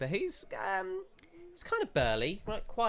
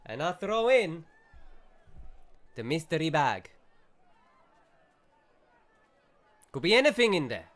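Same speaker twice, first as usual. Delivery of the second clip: louder, higher, fast mock Italian shopkeeper; shopkeeper accent; 5 semitones higher, 7 dB louder, faster than usual. Shopkeeper brushes off customer, louder fast mock Italian accent. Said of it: "So he goes".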